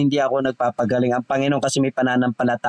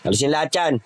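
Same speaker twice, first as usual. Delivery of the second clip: commanding spirits. Acted. Healer commands spirits to leave.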